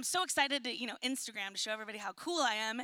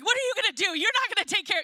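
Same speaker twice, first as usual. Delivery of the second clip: higher, louder exasperated outburst; frustrated panic; 7 semitones higher, 8 dB louder, faster than usual. Retold or imitated. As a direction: throw it at the husband in disbelief. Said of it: "I'm starting to panic and I'm getting frustrated".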